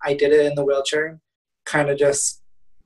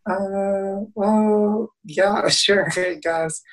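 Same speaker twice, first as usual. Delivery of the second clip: higher, hesitant and sheepish with a small laugh; awkward hesitant reply; 5 semitones higher, much slower than usual. Retold or imitated. Embarrassed to the kind old man offering help, hesitant and higher. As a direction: fumble for words, embarrassed to accept help.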